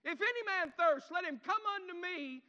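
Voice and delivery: loud, high preacher's shout; passionate cry aloud. Acted. Jesus calling to the crowd at the feast, crying aloud. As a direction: cry it out over a rejoicing crowd. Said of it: "and then he cries aloud".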